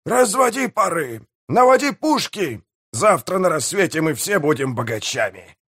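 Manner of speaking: high-pitched captain's orders, easing at the end; yelled commands. A narrator's reading. Pirate mayor readies the guns and promises riches, high calling. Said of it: "заорал пиратский мэр".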